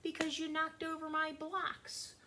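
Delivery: put-on a high, childlike "I'm feeling very angry"; modeling child speech. Teacher modeling what to tell friends, in a high childlike voice. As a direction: model the words a child would say. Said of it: "you should try to tell your friends".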